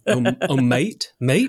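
unsure, questioning try at Australian; failed Australian accent. Acted. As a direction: test out a bad Australian accent, lifting at the end. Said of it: "I also can't do an Australian accent".

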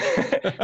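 very high squeak of laughter; squeaky laugh. Real, not acted. Laughing mid-story.

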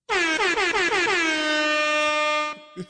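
big high burst of real laughter; loud laugh. Real, not acted. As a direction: crack up loudly at his own joke. Laughing at his own line.

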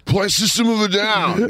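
high, pushy caller demanding a song; mock meth-head caller. Meth-head caller on the radio line, high and pushy. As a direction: yell the request like a wired caller.

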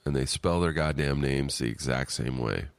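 ordinary delivery

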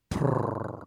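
low purring sound, mocking; imitated purr. Retelling a date's purr to his friend, mock purr. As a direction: roll a low cat purr. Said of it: "then she started going".

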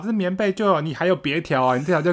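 high, exasperated aunt voice; aunt scolding the cousin over a blanket, high and exasperated. Retold or imitated. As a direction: mimic the aunt telling the kid to just give it up. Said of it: "我阿姨就会说".

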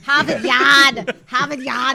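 loud, drawn-out mock Boston accent. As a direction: belt it out in a broad Boston accent. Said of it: "New York Boston accent".

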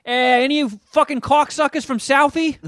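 high-pitched, mock Boston loudmouth; Bill Burr. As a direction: burst in as a hammed-up Southie tough guy. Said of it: "Bill Burr walks in with a beer".

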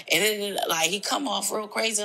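usual speaking voice

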